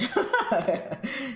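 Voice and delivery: short chuckle, pitched high; amused laugh. Real, not acted. Streamer reacts to a viewer's comment, amused laugh. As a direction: laugh lightly to yourself.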